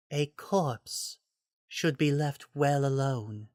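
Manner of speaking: a hint of a cold warning; Lady Maria voice. A narrator's reading. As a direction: warn the hunter calmly, aloof. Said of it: "she told them".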